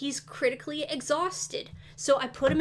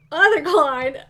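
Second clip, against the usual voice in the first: louder, laughing through the words; giggling at how savage you were; 2 semitones higher, 11 dB louder, slower than usual. Real, not acted. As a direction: crack up at your own joke names. Laughing at own silly baby names.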